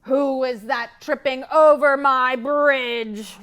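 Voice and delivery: loud, slow troll roar; troll roaring at the goat. Acted. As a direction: bellow from below. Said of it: "roared the troll".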